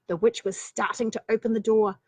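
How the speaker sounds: ordinary delivery, nothing marked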